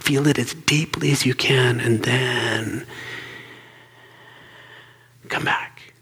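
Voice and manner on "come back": usual speaking voice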